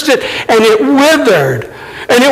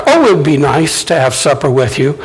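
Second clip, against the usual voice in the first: offhand casual; 7 semitones lower, faster than usual. Retold or imitated. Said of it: "not just like".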